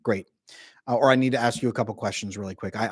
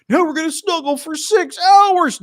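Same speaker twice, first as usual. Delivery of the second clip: high, slow, sing-song clinging whine; mock clingy partner; 9 dB louder, slower than usual. Mocking the clingy partner who won't leave, in a high slow whine. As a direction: whine like you never want to let go. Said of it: "You don't be like".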